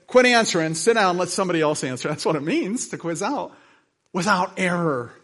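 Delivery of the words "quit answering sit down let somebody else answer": louder mock quizmaster command; a hint of a quizmaster calling time; quizmaster's order. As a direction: bark it out like a stern quizmaster. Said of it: "the quizmaster said".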